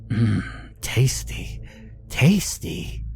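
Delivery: slow, savouring little goblin; relishing creature. A narrator's reading. Gnarled creature lifting the baby out, slow and relishing.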